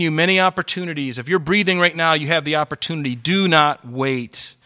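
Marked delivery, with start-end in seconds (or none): 3.1-4.6 slow, urgent plea. Real, not acted. Repeated urgent appeal.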